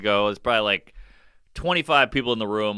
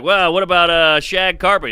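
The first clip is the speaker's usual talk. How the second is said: higher, louder hopeful pitch; pushy stage voice trying another joke; comic trying again; 3 semitones higher, 5 dB louder than usual. Retold or imitated. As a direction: float the next bit out to the room. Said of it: "they mimic my bomb".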